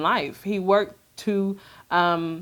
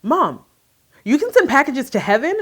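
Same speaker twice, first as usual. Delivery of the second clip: put-on high, louder wide-eyed kid voice; amazed little boy; 7 semitones higher, 3 dB louder than usual. Mother voicing her son amazed about sending packages to heaven, high and wondering. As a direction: play her son in awe. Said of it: "He's like".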